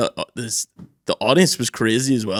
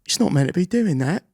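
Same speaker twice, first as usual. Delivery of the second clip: mock conductor woman; 6 semitones higher than usual. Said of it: "She was like".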